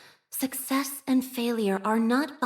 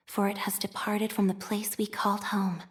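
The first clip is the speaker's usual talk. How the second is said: hushed scripture whisper. Acted. Devout whisper to the faithful about their lost home. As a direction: breathe the words like a secret prayer.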